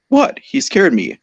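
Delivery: defensive squeak on the first word; startled, high self-defence; high-pitched protest. A narrator's reading. Embarrassed after girlish scream.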